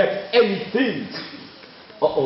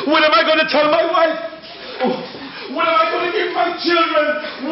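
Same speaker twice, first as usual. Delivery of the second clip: loud, high anguished questions; despairing cry; 9 semitones higher, 3 dB louder than usual. Acted. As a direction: wail it out in despair. Chief loses the deer, despairs.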